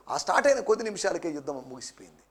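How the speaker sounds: normal talking voice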